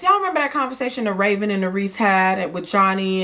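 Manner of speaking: ordinary talk, nothing marked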